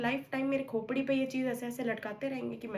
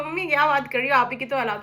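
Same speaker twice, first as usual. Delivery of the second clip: cheeky comeback; 8 dB louder than usual. Retold or imitated. Cheeky reply to mum.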